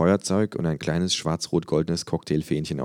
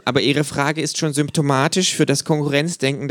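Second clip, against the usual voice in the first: high, dry, know-it-all kangaroo; 9 semitones higher, 3 dB louder than usual. A narrator's reading. Kangaroo lecturing a flag-draped fan, high and dry. Känguru voice, held.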